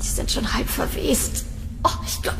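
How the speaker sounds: disgusted whisper. Acted. Sees rotting birds, feels sick.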